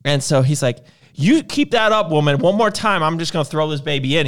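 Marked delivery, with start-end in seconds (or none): 1.1-4.3 louder, higher retold warning; mock threat. Husband threatening wife on the boat, mock angry. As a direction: imitate an angry husband laying down an ultimatum.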